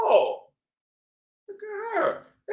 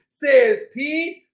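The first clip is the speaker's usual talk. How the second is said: ordinary delivery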